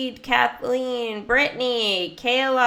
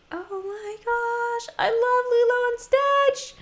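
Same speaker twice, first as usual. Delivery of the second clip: squealing much higher with delight; excited gush. Real, not acted. Streamer squealing to viewers over Lilo and Stitch, thrilled.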